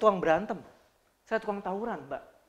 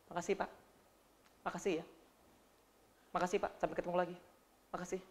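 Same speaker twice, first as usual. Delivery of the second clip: bored stewardess thanks; 2 semitones lower, 8 dB quieter, faster than usual. Retold or imitated. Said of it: "salah mengucapkan kata terima kasih".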